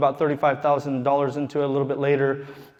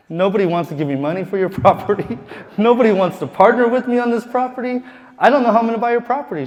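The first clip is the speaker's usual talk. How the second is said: louder, higher, rushed exasperation; exasperated rant; 7 semitones higher, 5 dB louder, faster than usual. Retold or imitated. Telling the lady nobody will fund him, exasperated and rushed. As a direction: blurt it out, fed up and desperate.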